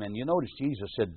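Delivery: ordinary talk, nothing marked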